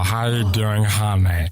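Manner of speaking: drawling flirty character voice; put-on character voice. Shown as a demonstration. Showing friends another voice, put-on flirty character. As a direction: sweet-talk in a silly put-on voice. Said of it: "I can do this voice as well".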